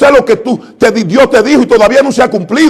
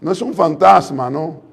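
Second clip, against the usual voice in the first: usual speaking voice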